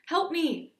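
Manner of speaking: high, loud cry for rescue; calling for help. A narrator's reading. Buried friend calling to Aiden, calling for help. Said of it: "called a familiar voice".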